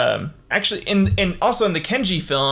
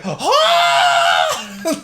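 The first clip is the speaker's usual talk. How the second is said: mock battle cry; 5 dB louder than usual. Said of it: "he's like".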